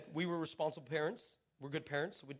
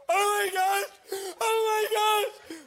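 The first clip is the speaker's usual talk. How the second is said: high, loud, slow sobbing cry; overwhelmed crying; 13 semitones higher, 7 dB louder, much slower than usual. Retold or imitated. Waiter overwhelmed by the boy's words, crying loudly. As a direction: wail it out, completely undone.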